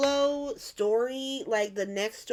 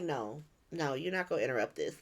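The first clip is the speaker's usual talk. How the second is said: low, softer, firm refusal; quiet refusal; 8 semitones lower, 7 dB quieter than usual. Retold or imitated. Jason stopping Lucas at the church, quietly firm. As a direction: imitate someone calmly shutting it down.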